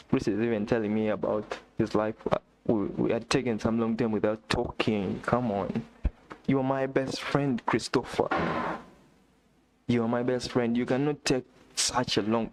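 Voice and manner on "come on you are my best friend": ordinary talk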